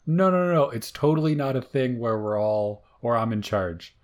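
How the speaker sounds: mock denial. Mocking those really in charge to co-host, hammed-up denial. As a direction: deny it too insistently, as a joke.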